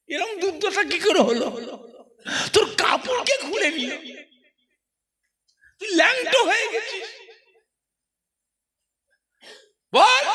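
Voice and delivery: a frantic mother demanding answers, pitch rising to a cry; upset mother. Acted. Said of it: "আর মা তো রাগ করছে".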